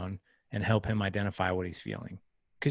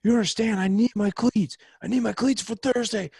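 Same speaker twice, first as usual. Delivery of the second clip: put-on much higher and louder, a whiny teenage outburst; imitating upset son; 12 semitones higher, 8 dB louder than usual. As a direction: mimic a kid losing it over his football cleats. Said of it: "not yelling at me, but yelling".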